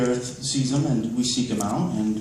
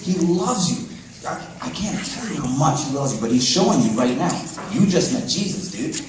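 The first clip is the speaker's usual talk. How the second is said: high-pitched, impassioned assurance; fervent witnessing; 7 semitones higher, 3 dB louder than usual. Retold or imitated. Telling a healed teenager that Jesus loves him, fervent. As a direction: preach it to one person with urgent joy.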